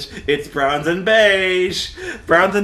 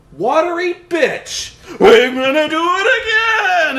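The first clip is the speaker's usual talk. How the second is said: much higher, louder, slow cartoon rage; hammed-up furious fighter; 11 semitones higher, 6 dB louder, slower than usual. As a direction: shriek the insult and vow like a silly villain. Mocking the furious movie fighter.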